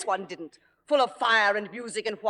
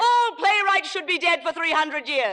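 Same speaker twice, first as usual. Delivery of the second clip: high, heated theatrical outburst; angry retort; 8 semitones higher, 3 dB louder than usual. Acted. Star attacking the playwright who criticised her, angry retort. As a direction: fling it back, pitched high.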